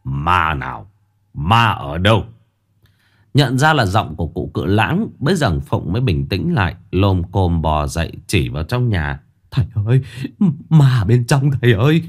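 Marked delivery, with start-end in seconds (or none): 0.0-2.2 trầm và nhỏ, giọng ông già chậm rãi; hạ giọng xuống như cụ già điềm đạm; giọng ông lão. Acted. Ông cụ hỏi đệ tử. 9.5-12.0 hốt hoảng gọi. Acted. Phụng hốt hoảng gọi thầy.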